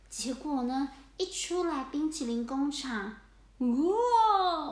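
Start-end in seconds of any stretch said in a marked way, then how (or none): 3.6-4.7 loud, high cry of shock; startled wow. Acted. Cats stepping out into the snow, loud startled cry. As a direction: gasp at the sudden blizzard.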